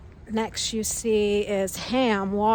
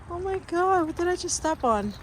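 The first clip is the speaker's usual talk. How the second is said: high-pitched alarmed exclamation; real startled reaction, pitched up; 8 semitones higher than usual. Real, not acted. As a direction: blurt it out, startled by what's underfoot. Steps on something unseen.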